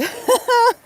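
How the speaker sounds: a sudden high giggle, real and bright; high burst of laughter. Real, not acted. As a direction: break into a high laugh at the thought of Jay. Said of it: "So I'm laughing because".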